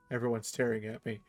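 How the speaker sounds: normal talking voice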